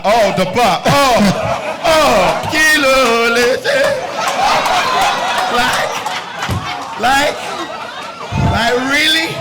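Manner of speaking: very high, loud, laughing squeal of shock; stunned disbelief. Retold or imitated. Actor hearing the director's thanks, squealing in disbelief.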